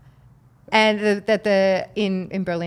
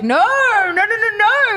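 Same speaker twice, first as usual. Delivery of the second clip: high, louder string of quick nos; emphatic no; 12 semitones higher, 6 dB louder than usual. Retold or imitated. TV bosses reassuring the host, with eager high nos. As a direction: insist warmly she keeps her accent. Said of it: "They're like".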